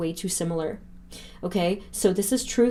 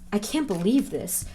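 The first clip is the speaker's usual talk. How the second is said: ordinary talk, nothing marked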